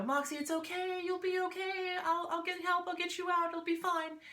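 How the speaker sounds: high, soothing reassurance; reassuring. Retold or imitated. Soothing her kitten stuck in the wall, high and reassuring. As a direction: coax a scared kitten gently, pitched high.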